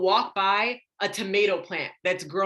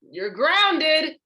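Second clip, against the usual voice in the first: high, loud mock telling-off; scolding parent; 7 semitones higher, 5 dB louder, slower than usual. Parent grounding a child over a B, mock scolding.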